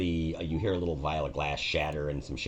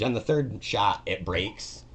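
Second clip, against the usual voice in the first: ordinary talk, nothing marked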